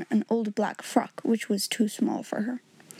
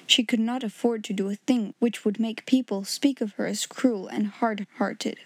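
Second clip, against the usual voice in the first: ordinary delivery, nothing marked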